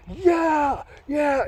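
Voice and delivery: high, drawn-out victory cry; celebratory yell. Retold or imitated. Runner celebrating the fifty mark at the top, triumphant yell. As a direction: re-enact a triumphant shout. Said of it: "that celebration at that top".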